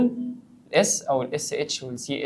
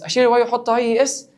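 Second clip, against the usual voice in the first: mock disbelief; 9 semitones higher, 5 dB louder than usual. Teacher mocks the student's answer.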